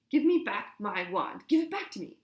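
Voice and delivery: high, loud, shrill order; furious demand. A narrator's reading. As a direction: demand it back in a rage. Hermione demanding her wand.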